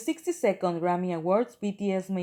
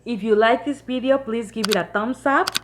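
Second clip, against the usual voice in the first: YouTube outro; 2 semitones higher, 8 dB louder than usual. Acted. Outro call to subscribe.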